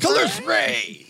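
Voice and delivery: slow, high spell shout. Acted. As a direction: bellow the spell name. Calling out the spell.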